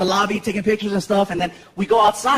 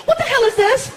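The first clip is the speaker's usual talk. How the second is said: high-pitched impression of a girlfriend; 14 semitones higher than usual. Girlfriend finding cakes in the kitchen, high-pitched challenge. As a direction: confront him in a high girlfriend voice. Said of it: "and she's like".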